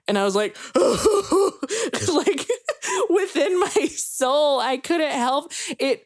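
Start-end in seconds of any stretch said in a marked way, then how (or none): 0.4-3.0 high-pitched imitated sobs; mock sobbing. Fan retells breaking down at forgiveness, mock sobbing. 3.1-6.0 high, slow, half-laughing wail; mock tearful. Fan retells being overcome at the show, mock tearful.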